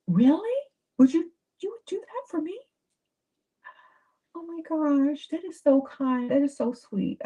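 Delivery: high, gushing mock delight; mock excited. Woman gushing at her date's offer, mock excited. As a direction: act thrilled that he offered. Acting out the gift scene.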